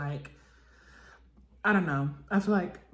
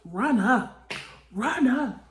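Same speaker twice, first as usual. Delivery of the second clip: louder each "run up", rising higher; quoting Razor's challenge, getting louder and higher; mock yell building; 4 semitones higher, 5 dB louder than usual. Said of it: "she's like".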